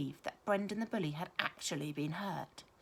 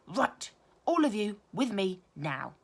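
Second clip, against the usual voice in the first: slow, loud stern order; a teacher marching children inside; slow firm commanding voice; 2 semitones higher, 6 dB louder, slower than usual. A narrator's reading. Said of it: "ordered Missus Sanders".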